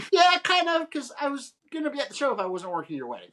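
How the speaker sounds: high, quick, mock-sheepish reply; sheepish admission. Photographer answers the bride quickly in a high, sheepish voice. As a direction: rush it out in a high, guilty-fan voice. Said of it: "I was like".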